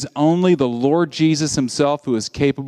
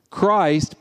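usual speaking voice

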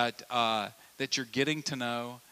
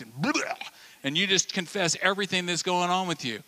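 ordinary talk, nothing marked

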